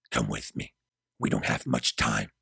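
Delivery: very low-pitched male character; urgent Gabriel. A narrator's reading. Gabriel urges Mari to leave with him, deep urgent voice. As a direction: urge her along in a deep voice.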